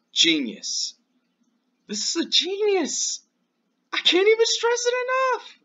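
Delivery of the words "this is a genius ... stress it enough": much higher, cracking with excitement; excited voice breaking up high; squeaky excited. Real, not acted. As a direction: squeal it like you can't contain yourself. Voice jumps very high.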